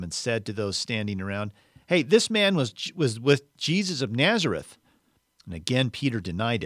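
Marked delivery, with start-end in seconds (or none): 1.9-4.8 high, loud alerting call; calling out an accusation. A narrator's reading. Servant girl pointing out Peter to bystanders, calling out. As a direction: call it out to bystanders, voiced by a reader.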